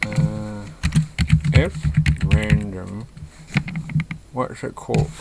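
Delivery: talking around a pen in the mouth. Real, not acted. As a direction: speak with a pen held between the teeth. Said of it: "So I got a pen in my mouth".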